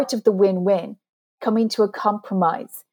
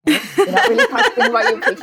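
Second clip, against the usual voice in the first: high, laughing mock scolding; laughing self-mockery; 14 semitones higher, 3 dB louder than usual. Coach quoting her conscience about her preaching, laughing. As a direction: tease yourself through real giggles.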